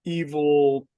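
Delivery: ordinary talk, nothing marked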